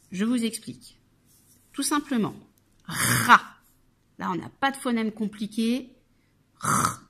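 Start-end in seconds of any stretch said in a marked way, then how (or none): 2.8-3.4 "ra" loud with a long rolled-out r; syllable sounding-out. Shown as a demonstration. Teacher presenting the first syllable, "ra" loud and separate. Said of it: "Première syllabe". 6.6-7.1 "R" as a bare held French r, no vowel; isolated phoneme. Shown as a demonstration. Teacher isolating a phoneme, "R" as a held throat sound. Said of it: "j'entends r".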